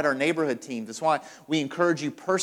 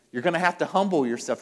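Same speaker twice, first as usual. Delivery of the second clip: usual speaking voice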